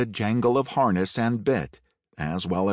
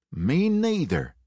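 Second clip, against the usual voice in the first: Ed's voice; 9 semitones higher than usual. A narrator's reading. Ed agrees.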